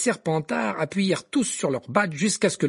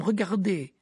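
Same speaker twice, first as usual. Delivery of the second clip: urgent protest; 6 semitones higher than usual. A narrator's reading. Ron protests to teacher.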